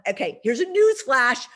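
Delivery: blunt reality check. Retold or imitated. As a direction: set the client straight, half-mock. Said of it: "Now, of course, I never do that".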